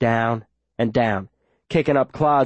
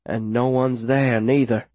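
plain reading voice

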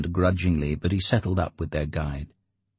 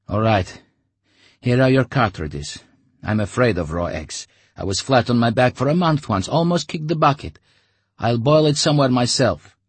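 louder, a bit higher nervous talk; wary Artyom; 6 semitones higher, 5 dB louder than usual. Acted. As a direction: explain it uneasily. Artyom explains.

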